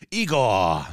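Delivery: low, hammed-up villain tag; cartoon villain. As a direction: call the henchman with mock menace. Back in the villain voice.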